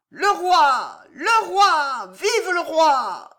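high, loud, slow cheer; crowd cheering. Acted. Courtiers hailing the arriving king: high, loud, slow cheer.

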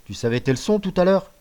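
high sarcastic question; suspicious father. Acted. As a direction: catch him out in his lie. (père soupçonneux et moqueur)

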